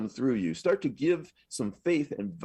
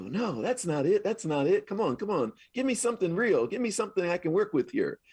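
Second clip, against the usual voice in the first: exasperated pleading; 6 semitones higher than usual. Retold or imitated. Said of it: "And I go".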